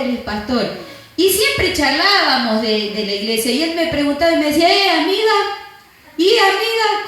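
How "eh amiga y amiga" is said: retold chirpy greeting. Retold coworker's friendly greeting.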